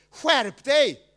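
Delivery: loud, sharp self-rebuke; scolding himself. Retold or imitated. Inner voice telling [name] off, loud scolding. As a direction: snap at yourself to pull it together. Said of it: "jag ligger och tänker så här".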